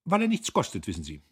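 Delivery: hoch und laut, neckend; kokett. A narrator's reading. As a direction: ihn mit einem Blick aufziehen. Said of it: "mit einem plötzlichen Blick". (kokett neckend, hoch einsetzend)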